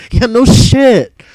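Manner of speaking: drawn-out, high-pitched "no shit"; emphatic agreement. Real, not acted. Streamer agreeing with viewer about getting fired, exasperated.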